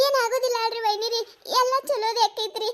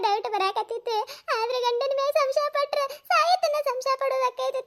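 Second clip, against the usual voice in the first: tearful wailing. Acted. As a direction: wail out your troubles to a confidante. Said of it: "ಹ್ಮ್, ಅಳ್ಬೇಡ".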